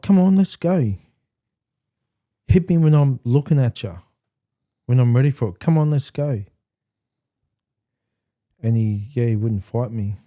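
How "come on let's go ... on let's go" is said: raised, higher-pitched retold dare; defiant challenge. Son daring his dad to hit him, raised defiant challenge. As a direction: call him out to fight, loud and high.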